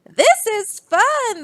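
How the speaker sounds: high, slow delighted chorus; delighted animals. Acted. The animals cheer as they ride, high and slow. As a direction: stretch it out, high and happy.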